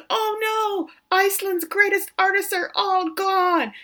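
high, drawn-out comic wail; mock freaking out. Retelling Sigrid's horror at the explosion, mock freak-out. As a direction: imitate someone aghast, hammed-up. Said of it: "They're freaking out".

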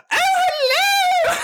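squeaky high Toad greeting. Retold or imitated. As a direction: chirp it like Toad. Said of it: "I had to go straight to".

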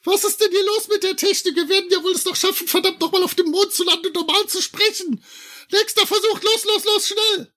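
squeaky high helium voice barking commands. Acted. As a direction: yell orders on helium. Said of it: "wenn [name] so bescheuert spricht".

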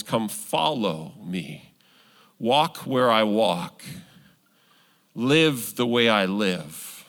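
slow, low and gentle call; a gentle, unhurried invitation to follow; quoting Jesus. Retold or imitated.

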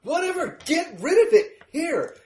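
high-pitched, irritated character yell; dismissive shout. Acted. Van driver brushing off the crew's debt excuse, irritated shouting.

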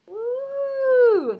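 long high "woo"; sustained high-pitched whoop; excited whoop. Real, not acted. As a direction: let out a held, high cheer. Cheering for having spells.

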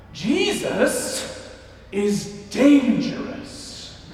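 slow, raspy bellowed line; smoker's rasp. Retold or imitated. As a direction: rasp it out slowly like an old preacher. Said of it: "in her smoker's rasp would bellow out".

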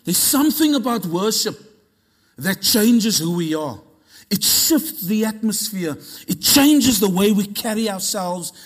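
forceful, building sermon delivery; impassioned preaching. Real, not acted. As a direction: declare it with fervour, leaning on key words.

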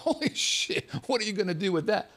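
high-pitched amazed gasp of words; stunned disbelief. Retold or imitated. Comic telling friends about the giant spliff, stunned disbelief. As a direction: blurt it out high, then quick and incredulous.

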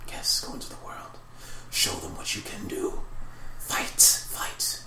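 urging demonic whisper. Acted. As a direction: whisper it slowly, goading them on to fight. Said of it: "And the whispers going".

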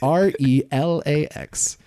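spelled out. Shown as a demonstration. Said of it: "five words for you".

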